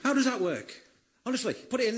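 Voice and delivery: ordinary talk, nothing marked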